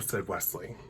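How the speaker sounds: ordinary delivery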